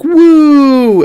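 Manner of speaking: very high, loud held cheer; cheering woo. A narrator's reading. Everyone cheering the kiss, high loud woo. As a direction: whoop it out like a crowd. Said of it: "Everyone said, like".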